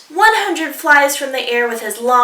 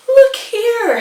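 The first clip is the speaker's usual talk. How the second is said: much higher, louder, a touch sleepy; yawning koala; 12 semitones higher, 7 dB louder than usual. Acted. Sleepy koala calling down to dingo, higher. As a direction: stretch it out like a yawn. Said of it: "he yawned".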